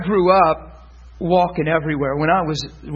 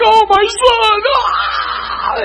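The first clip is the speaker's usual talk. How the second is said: high-pitched mock motherly wail; hammed-up cry of a relieved mother; overwrought mom; 4 dB louder, much slower than usual. Imitates overwrought moms greeting sons.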